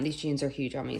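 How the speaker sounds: normal talking voice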